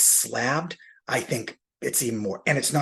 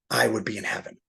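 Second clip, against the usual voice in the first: normal talking voice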